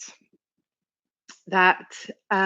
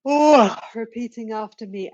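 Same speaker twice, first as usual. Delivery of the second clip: ordinary talk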